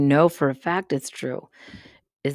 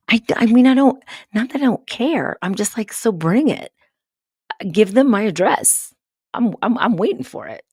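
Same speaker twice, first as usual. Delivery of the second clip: higher and louder, daring it to come; defiant excitement; 7 semitones higher, 6 dB louder than usual. Real, not acted. Daring the comet to come, loud and high. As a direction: bring it on, fired up and loud.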